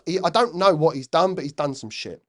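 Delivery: usual speaking voice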